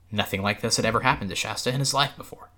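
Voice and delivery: usual speaking voice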